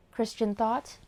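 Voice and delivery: ordinary delivery, nothing marked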